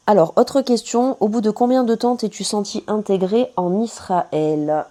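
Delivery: announcing a question. Real, not acted. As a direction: announce the next question loud and slowly. Reads out a viewer question.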